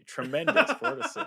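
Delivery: high-pitched, loud incredulous echo; mock incredulous. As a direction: squeal the verdict back in mock disbelief. Mock echo of the low score.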